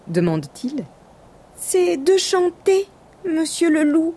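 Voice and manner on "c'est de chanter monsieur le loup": high, bravely polite lamb; a small creature hiding its trembling; the little lamb politely offers himself as dinner in a high voice. Acted. Lamb voice, held.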